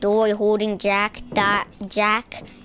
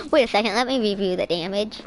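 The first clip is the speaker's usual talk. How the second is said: usual speaking voice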